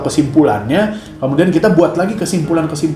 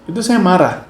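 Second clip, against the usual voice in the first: ordinary talk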